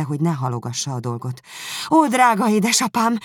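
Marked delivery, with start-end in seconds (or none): 1.9-3.2 high, loud imploring call; pleading cry. A narrator's reading. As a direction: cry out to father, begging. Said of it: "kiáltotta". (hangos, magas, könyörgő felkiáltás)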